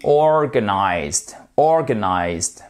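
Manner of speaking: "Organized" stretched, heavy stress on OR; first-syllable stress. Shown as a demonstration. Teacher pointing at the accent, slow "Organized" stressed on OR. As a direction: draw out OR, keep the rest of "Organized" light. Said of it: "Accent tonique ici".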